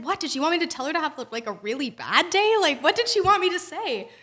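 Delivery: playing up the confusion; a touch of exasperated disbelief; incredulous. Disbelief retold to friend.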